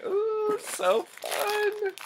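very high, thrilled coo; delighted squeal. Real, not acted. Delighted by a new toy.

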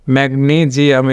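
ম্যাগনেসিয়াম with long "nee" and voiced "zi"; correct English pronunciation. Shown as a demonstration. Teacher repeats the corrected word, ম্যাগনেসিয়াম pronounced mag-NEE-zi-am. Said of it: "ম্যাগনেসিয়াম হবে".

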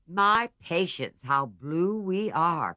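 drawn-out teasing exclamation; exclaiming. A narrator's reading. Jo teasing her gloomy sisters, drawn-out exclaiming. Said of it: "cried Jo".

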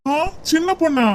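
amused laugh. Acted. Siva laughs at the tall girl called Chinna Ponnu, amused. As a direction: laugh at the odd name.

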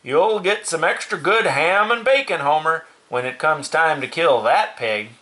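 high, emphatic character voice; hearty farmer. Acted. Farmer talking bacon to Homer, hearty high voice.

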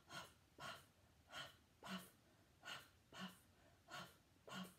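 unvoiced huff puff breaths; breathy creature huffing. Beast breathing in story.

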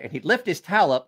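normal talking voice

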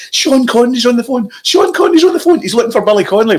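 very high-pitched excited announcement; hammed-up high frantic voice; frantic excitement. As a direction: burst out with astonishing news, imitating a thrilled colleague. Excited colleague's news retold.

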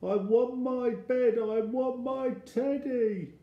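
put-on high, loud childish wail; very high-pitched, plaintive plea; scared little boy. A narrator's reading. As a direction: whine like a frightened child wanting comfort. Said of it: "I'm scared already".

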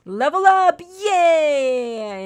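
high, loud celebratory whoop; big gleeful announcement; excited cheer. Real, not acted. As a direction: cheer the pet on with a drawn-out yay. Celebrating the dog levelling up.

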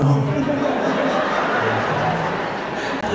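short embarrassed laugh. Real, not acted. Guest laughing at his own tears before the church, short laugh. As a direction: laugh it off, a bit shy.

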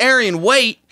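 retold shout. Host shouting after departing athlete, retold at half force. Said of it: "I even shouted".

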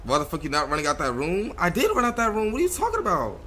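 ordinary talk, nothing marked

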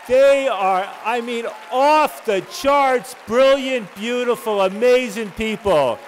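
loud, high rallying shout over the crowd; rousing shout-out. Real, not acted. Introducer rallying the room to thank colleagues, rousing shout. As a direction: whip up applause, loud and proud.

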